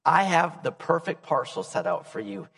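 soft, tender voice of God. Acted. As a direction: speak gently as a loving God. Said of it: "God said".

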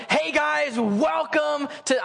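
high, hyped-up greeter; peppy welcome. Retold or imitated. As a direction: greet the new hires with big mock enthusiasm. Acting company's upbeat first-day welcome.

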